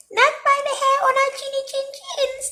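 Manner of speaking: very high, quick squeaky piggy voice; squealing little pig. A narrator's reading. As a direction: squeal it fast like a tiny pig. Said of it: "squealed the two little pigs".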